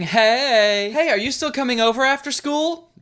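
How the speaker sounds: put-on high, peppy teen friend voice; friend character. Friend Grace greets Mia at school, high voice.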